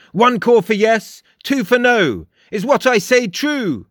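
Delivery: high, emphatic character voice; imperious, high and deliberate; commanding Frost. A narrator's reading. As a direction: give grand instructions, pitched well up. Frost questions the raven.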